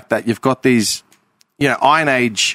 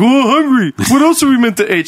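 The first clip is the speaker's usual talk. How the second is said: mock whiny voice; 11 semitones higher, 4 dB louder than usual. Mocking hungry friend in retold argument.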